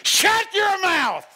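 high, slow, loud bellow; shouted command. Retold or imitated. Jesus rebuking the storm in a loud Texas bellow. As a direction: roar it down at the storm. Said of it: "What he literally said is".

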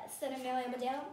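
ordinary delivery

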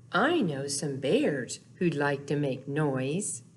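usual speaking voice

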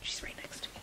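breathy whisper of relief; whispered aside. Real, not acted. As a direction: mutter it under your breath. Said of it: "whispered asides".

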